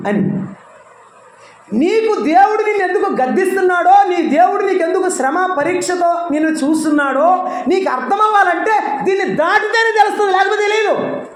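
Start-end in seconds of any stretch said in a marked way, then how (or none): 1.7-11.2 preaching at full force. Acted. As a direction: preach it out to the congregation, raised and ringing. (బిగ్గరగా, ఎత్తైన స్వరంతో ప్రసంగం)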